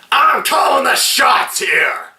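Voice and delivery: builds from level to a high yell; imitating Otis snapping at his captives; mock villain shout. Film reviewer quoting Otis, building into a mock shout.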